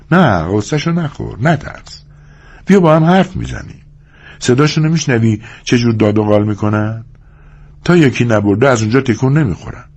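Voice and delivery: high-pitched woman's voice, coaxing; reassuring young woman. Acted. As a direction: coax him to talk, as the young woman.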